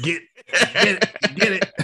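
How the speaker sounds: high, loud, laughing urge; mock urging shout. Urging the boy in the chair, loud and laughing. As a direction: egg him on through a laugh. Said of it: "yeah, I was like".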